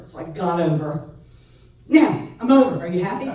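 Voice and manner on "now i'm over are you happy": loud, pointed "are you happy"; sarcastic annoyance. Retold or imitated. Son to mother after changing lanes, loud sarcastic jab. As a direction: throw it at her with a sneer. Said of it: "I was so mad at her".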